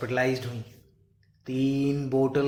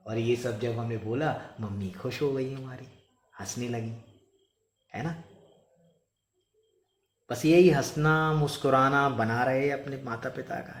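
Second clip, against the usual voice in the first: ordinary talk, nothing marked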